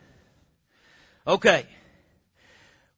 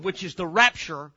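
ordinary talk

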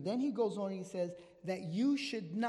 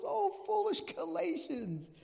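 high, slow exclamation of reproach; serious pastor's rebuke; 9 semitones higher, 4 dB louder, slower than usual. Acted. Serious pastor to his straying flock, raised rebuking cry. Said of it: "a very serious pastor".

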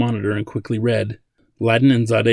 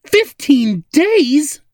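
slow, high, loud bellow of disbelief; 7 dB louder, much slower than usual. A narrator's reading. Zadeh learns of the deadline, bellowing. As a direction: bellow it, stretching each word way up high. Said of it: "Zadeh bellowed again".

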